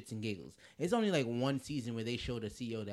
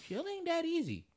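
high mock voice. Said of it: "I'm like".

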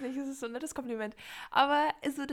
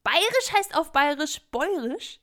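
surprised; 6 semitones higher, 6 dB louder than usual. Real, not acted. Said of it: "Bäuerisch, einfach bayerisch".

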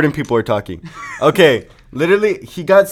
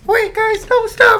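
high-pitched mock choking plea; hammed-up, squeaky begging; Ethan's point of view; slower than usual. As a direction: squeal it like you're being strangled. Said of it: "I'm gonna give you guys Ethan P O V".

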